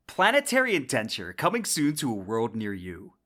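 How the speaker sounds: ordinary delivery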